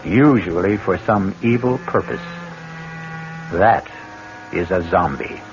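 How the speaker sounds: a horror show host welcoming listeners into the dark; spooky host narration. Acted. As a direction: savour every dread word like a horror host.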